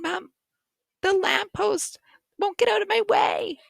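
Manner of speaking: high-pitched complaint to mom; whining kid excuse. Acted. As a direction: whine it high, like a kid stalling.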